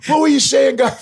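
tough guys caught out, apologizing; sheepish mobsters. Retold or imitated. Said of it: "He's back to back going".

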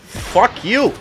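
a sudden high, loud yell at the screen; angry outburst. Real, not acted. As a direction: snap it out at the enemy. Raging at opponent in game.

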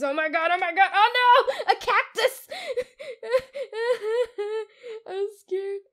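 high, loud frightened squeal; panicked laughing shriek. Real, not acted. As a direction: scream while laughing. Panicked by mob.

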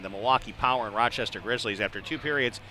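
usual speaking voice

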